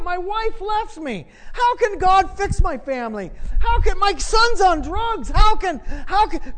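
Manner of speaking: high-pitched, building protest; mock complaining. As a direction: act out doubters piling up their complaints. Acts out the people's complaining.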